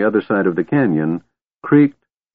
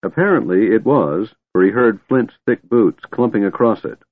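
usual speaking voice

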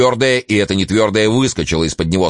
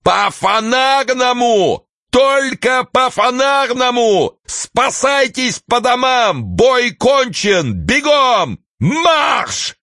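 very high, slow howled command; bugle-like howl; much slower than usual. A narrator's reading. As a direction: blare it like a cavalry trumpet. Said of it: "провыл, как кавалерийская труба".

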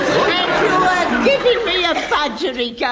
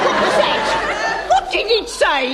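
startled; 6 semitones higher, 4 dB louder than usual. Acted. Landlady startled by budgie.

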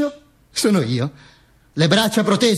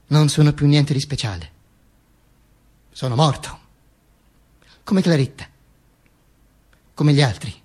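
subdued resignation; 3 semitones lower than usual. Acted. Dead man's slow final litany.

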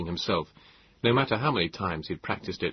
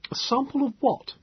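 put-on pompous young man.

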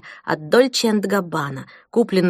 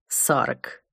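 low, drawn out; 6 semitones lower, 7 dB quieter than usual. Acted. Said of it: "потом повторила, растягивая слоги".

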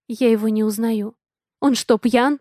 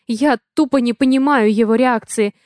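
ordinary delivery, nothing marked

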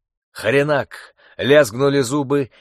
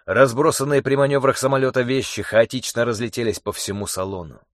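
ordinary delivery

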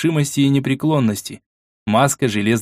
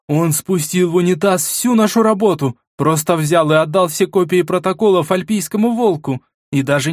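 higher, exasperated ranting woman; angry woman; 7 semitones higher than usual. Acted. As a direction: rant like a fuming market woman. Vasilisa ranting in anger.

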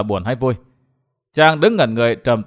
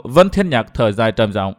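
ordinary delivery

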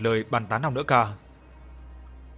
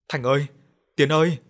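raised, louder call for two men; calling out loudly; 3 semitones higher, 5 dB louder than usual. A narrator's reading. Woman running up to the two men, calling out loudly. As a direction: call out urgently across a distance. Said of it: "Miệng bà ta không ngừng gọi lớn".

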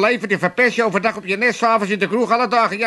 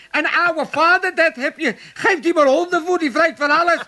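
indignant outburst; 9 semitones higher, 3 dB louder than usual. Acted. Realises he ate dog food.

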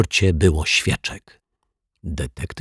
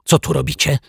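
sharp question; 9 semitones higher, faster than usual. A narrator's reading. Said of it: "zapytał ostro".

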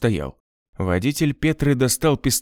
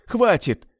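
крик; 10 semitones higher than usual. A narrator's reading. Айзек кричит дальше.

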